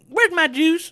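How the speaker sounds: high hillbilly call; high West Virginia voice; mock Jimbo Fisher. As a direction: squeak it like a hillbilly coach.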